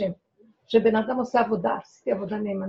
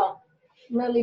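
usual speaking voice

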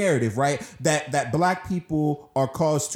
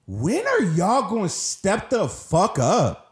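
heated, raised pitch, drawn-out words; real upset; 4 semitones higher, slower than usual. Real, not acted. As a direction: demand action, slow and exasperated. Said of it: "I'm getting so upset".

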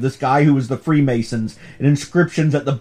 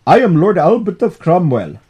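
put-on accidental Indian accent, a little louder; 2 semitones higher, 5 dB louder than usual. Podcaster voicing a British lord for cohost, botched Indian accent. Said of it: "I just did an Indian accent".

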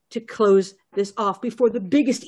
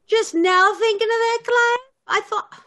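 higher, louder eager voice; excited; 12 semitones higher, 4 dB louder than usual. Acted. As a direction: jump in excitedly. Professor Lamb excited, higher and louder.

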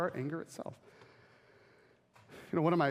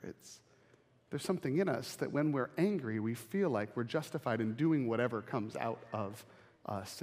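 ordinary talk

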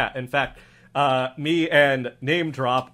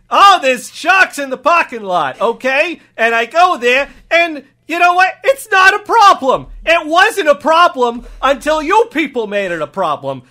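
put-on indignant cop with a thick regional accent; emphatic, rising cop complaint; exasperated cop; 13 semitones higher, 13 dB louder than usual. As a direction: play an aggrieved local cop laying down the facts. Improv scene as cop.

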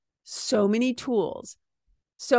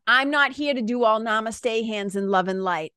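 ordinary delivery

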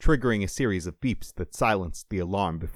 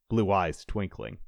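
usual speaking voice

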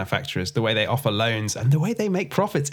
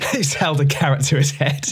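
laughing; 3 semitones higher than usual. Real, not acted. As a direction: blurt it through laughter. Joking about carrot metaphor.

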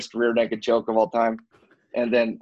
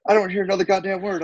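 raised-pitch mock scolding; imitated angry sergeant; 7 semitones higher than usual. First sergeant silencing the airman by phone, mock angry. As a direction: lay down the law, mimicking a superior.

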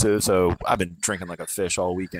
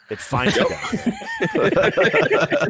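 laughing; 7 dB louder, much slower than usual. Real, not acted. Laughing at his own joke.

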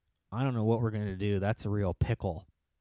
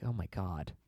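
usual speaking voice

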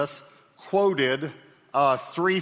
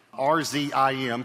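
ordinary talk, nothing marked

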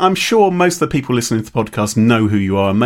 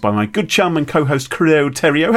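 usual speaking voice

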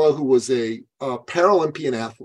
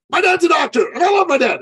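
high, loud mock-earnest voice; naive client; 4 dB louder, faster than usual. Client telling his coach why doctors, high and loud. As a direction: blurt it out like a proud son.

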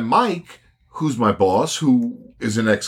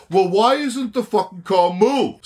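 retold yell; 8 semitones higher than usual. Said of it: "So he yells".

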